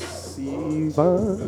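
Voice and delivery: usual speaking voice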